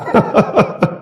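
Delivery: high, light derisive chuckle; mocking villain laugh. Villain scoffing at the listener's story, mocking laugh. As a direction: laugh scornfully at a nobody.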